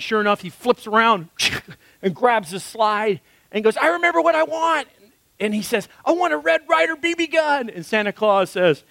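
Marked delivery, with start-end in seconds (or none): 3.8-4.9 put-on high, excited little boy; kid Ralphie voice. Ralphie blurts to Santa what he wants, in a high little-boy voice. 6.0-7.7 put-on high, excited little boy; kid Ralphie voice. Ralphie blurts to Santa what he wants, in a high little-boy voice.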